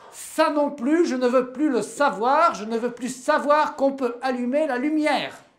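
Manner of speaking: loud, high and insistent; husband shouting at his wife; angry outburst. Acted. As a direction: shout him down as you rip out the lamp. Man tears down the lamp.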